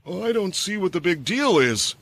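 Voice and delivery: defensive. A narrator's reading. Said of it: "the bowman said defensively".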